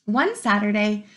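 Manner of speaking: lively storybook read-aloud; reading to children. Acted. Teacher reading to her class, lively and expressive. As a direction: bring the story alive for the kids.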